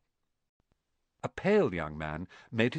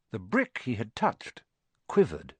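ordinary delivery

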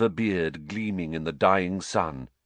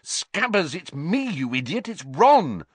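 hissed; 8 semitones higher, 4 dB louder than usual. A narrator's reading. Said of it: "Ron hissed".

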